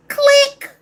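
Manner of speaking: high, loud mouth click of a hang-up; phone click sound. Shown as a demonstration. A caller acting out hanging up on stressful calls with a high, loud click. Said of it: "You know what I'm doing?".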